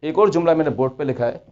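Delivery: ordinary talk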